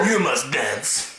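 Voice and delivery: slow, hammed-up German-Russian command; mock German-Russian accent. Podcaster mimicking the dance instructor, mock German-Russian accent. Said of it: "she's got this German accent".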